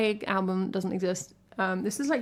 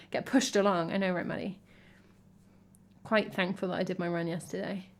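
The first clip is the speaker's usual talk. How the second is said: normal talking voice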